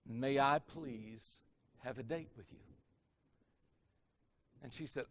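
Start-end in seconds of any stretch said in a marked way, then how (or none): 0.1-2.6 soft, careful and clear; smooth sincere request. Retold or imitated. Joe asking the campus beauty for a date, gently. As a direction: ask her gently, every word clean. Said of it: "without even the slightest speech slur".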